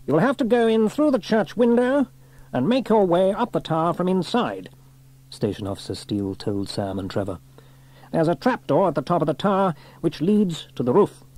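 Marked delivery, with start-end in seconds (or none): 0.1-4.7 high, raised officer's voice; station officer giving orders. A narrator's reading. Station officer sending Sam and Trevor into the church, commanding. 8.1-11.2 high-pitched officer's briefing voice; brief the crew with authority; station officer giving orders. A narrator's reading. Station officer explaining the way up to the roof, commanding.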